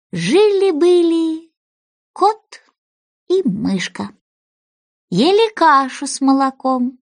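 slow, sing-song nursery rhyme; slow singsong rhyme recital; rhyming tale. A narrator's reading. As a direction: chant it like a children's verse. Mouse's tale read as rhyme.

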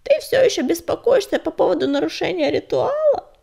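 put-on creaky old voice. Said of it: "раздался скрипучий голос Лоскута".

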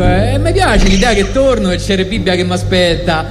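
ordinary delivery, nothing marked

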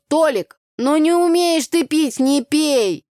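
loud, high folksy scolding; Tanya scolding. Acted. Tanya telling Tolik off, loud and brash. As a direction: scold a drunk friend, village style. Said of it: "А я ему сразу сказала".

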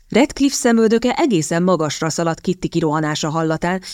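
ordinary delivery

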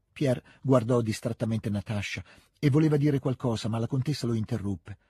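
usual speaking voice

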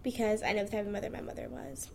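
ordinary talk

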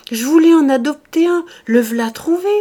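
loud, high, delighted; gleeful rubbing hands. A narrator's reading. Farmer gloats to himself, loud and delighted. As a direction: relish the good news aloud.

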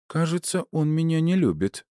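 high-pitched, sardonic remark; high female character voice with attitude; snide character voice. A narrator's reading. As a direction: pitch it well up, mock-wondering. Meg remarks on butler's glare.

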